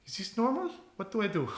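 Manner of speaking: high-pitched, flustered character voice; helpless high plea for guidance; bewildered questioning. Acted. Character looks at the party for help, high bewildered questioning.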